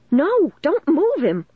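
high, drawn-out alarmed cry; slow, high, desperate protest. A narrator's reading. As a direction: cry out to stop them. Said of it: "I cried".

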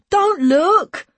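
high, loud warning; calling out. A narrator's reading. Boy about to pee by the wall, loud warning. Boy orders officer to turn away.

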